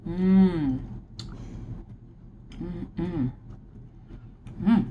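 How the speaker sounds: pleased hum while chewing; savoring mmm. Real, not acted. Tasting the frosted cookie.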